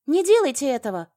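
high, loud pleading scream; sudden shrieked plea; alarmed cry. A narrator's reading. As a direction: cry out to stop them. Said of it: "вскрикнула я".

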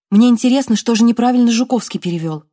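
high, loud, insistent demand; insist, eager and commanding. Acted. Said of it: "потребовала Вероника". (требовательно, громко и высоко)

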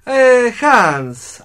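a loud, high call for Hans. Acted. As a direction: hail him from a distance. Doctor calls to the assistant.

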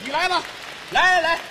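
daring challenge. Acted. Challenging partner to sing.

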